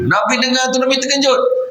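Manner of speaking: preacher jumping up high to show the Prophet's shock; dramatic shock. Acted. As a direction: gasp out how shocked he was.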